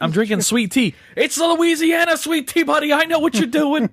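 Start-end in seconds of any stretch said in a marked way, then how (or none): 1.2-3.9 a hammed-up Southern-ish protest, pitched way up. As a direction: protest your innocence in a squeaky put-on voice. Drunk-seeming guy defending himself.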